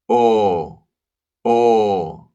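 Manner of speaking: teacher shows students the Spanish O; round O. Shown as a demonstration. Lips rounded, pure O vowel. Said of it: "Pay attention to the position of your lips".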